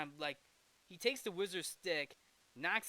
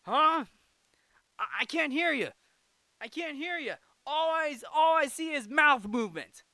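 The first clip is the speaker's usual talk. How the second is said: high, loud shout across distance; 10 semitones higher, 10 dB louder than usual. Retold or imitated. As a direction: yell like you can't hear them. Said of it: "Shazam was like".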